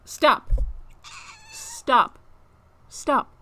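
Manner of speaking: loud, high stop with a squeaky peak; harsh scolding. Real, not acted. Streamer telling her cat off harshly, loud and high. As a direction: snap at the cat, firm. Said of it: "I'm being too harsh".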